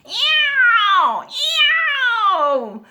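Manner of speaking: long, high, loud yowl; screeching cat meow. A narrator's reading. Cat screeches at the squeaky bed, loud yowl. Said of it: "screeched the cat".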